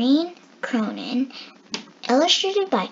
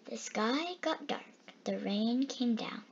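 usual speaking voice

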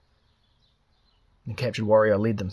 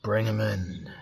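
a hint of a slurred, snarling chief; lightly voiced drunken warlord; slower than usual. A narrator's reading. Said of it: "A slurred voice snarled".

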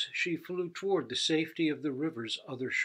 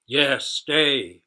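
loud tiger roar; mighty tiger roaring stay; 10 dB louder than usual. A narrator's reading. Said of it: "roared the mighty tiger".

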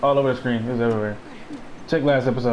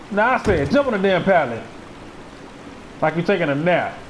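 normal talking voice

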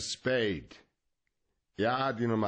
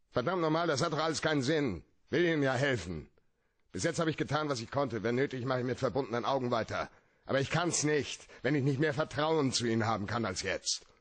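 higher, frustrated, the words tumbling out faster; angry outburst; 6 semitones higher, faster than usual. Acted. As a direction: vent your anger at her lies, pushing hard. Spade's outburst at Brigid.

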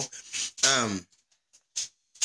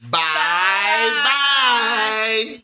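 shouted goodbye; 4 semitones higher, 6 dB louder, much slower than usual. Real, not acted. Loud sign-off goodbye.

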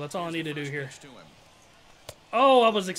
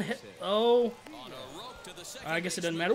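usual speaking voice

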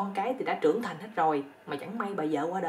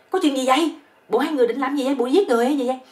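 loud, higher acted outcry; yelling; 6 semitones higher, 8 dB louder than usual. Hotel owner bursting in on the couple, loud mock yell. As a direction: shout in hammed-up alarm. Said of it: "ông chủ khách sạn nó mới la lên".

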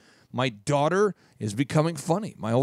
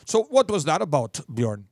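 put-on Minnesota accent. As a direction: imitate a Minnesotan, rounding out the vowels. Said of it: "Minnesota people also say".

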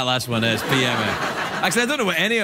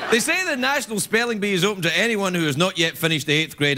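usual speaking voice

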